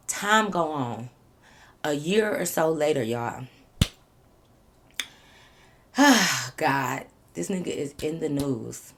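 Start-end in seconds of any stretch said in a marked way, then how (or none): 5.9-6.5 real weary exhale; heavy sigh. Real, not acted. Storyteller about to reveal he's in the news, heavy sigh. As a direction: let out a sigh before bad news.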